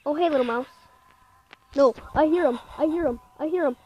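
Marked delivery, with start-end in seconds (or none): 1.8-3.8 panicked. Real, not acted. Hears the ghost approaching.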